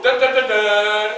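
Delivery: slow, low suspense sting, voiced; slow dramatic doom chant; ominous dun dun. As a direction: hum it like a movie suspense cue. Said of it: "somebody go".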